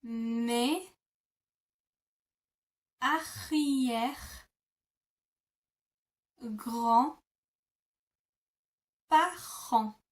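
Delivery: mes, arrière, grand, parents each said alone with long pauses; slow word-by-word demo. Shown as a demonstration. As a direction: pause after every word. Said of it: "break that down even further".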